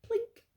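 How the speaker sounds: high little plink, like a coin dropping in a jar; penny plink sound. Retold or imitated. Penny lands in jar, high imitated plink. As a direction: chirp the plink of a penny landing.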